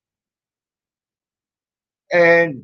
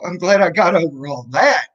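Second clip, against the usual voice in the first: normal talking voice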